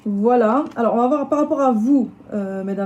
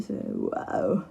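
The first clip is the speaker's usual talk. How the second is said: cracked voice; 14 semitones lower, 7 dB quieter than usual. Real, not acted. Reader laughing at her own broken voice, croaking. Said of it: "j'ai la voix un peu cassée".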